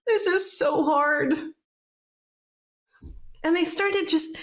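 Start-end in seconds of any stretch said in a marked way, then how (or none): 0.1-1.3 upset wail. Acted. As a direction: cry it out, overwhelmed. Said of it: "she made hurricanes of upset".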